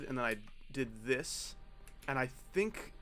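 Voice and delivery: normal talking voice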